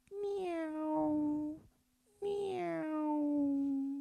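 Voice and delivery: very high, soft meows; soft, high cat meows; cat meowing. Acted. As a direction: mew like a sweet cat. Cat answers with his voice.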